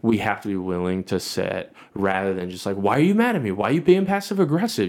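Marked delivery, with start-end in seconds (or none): 2.8-4.9 higher, louder accusing questions; mock confrontation. Husband showing how not to confront his wife, mock accusing. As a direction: fire off heated, high-pitched demands. Acting out confronting spouse in argument.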